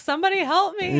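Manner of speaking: hammed-up helpless plea; mock plea for help. New to all this, pleading to be guided, mock-helpless. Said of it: "And I'm like".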